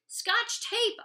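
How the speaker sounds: put-on high, loud excited kid yell. A narrator's reading. Oliver to the class, a loud high excited yell. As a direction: shout it out, thrilled. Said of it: "Oliver yells".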